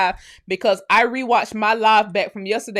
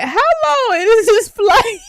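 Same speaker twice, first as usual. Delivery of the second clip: incredulous, dragged out; 11 semitones higher, 7 dB louder, much slower than usual. Retold or imitated. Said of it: "looking at the flight path like".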